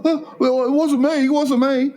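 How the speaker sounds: high, sheepish denial; defensive boyfriend. Retold or imitated. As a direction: squeak out an embarrassed denial. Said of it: "He's going".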